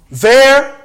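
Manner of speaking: sudden high, loud call; high exclaim. Real, not acted. Preacher pointing out Jesus on the boat, loud and high. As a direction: fling the word out high.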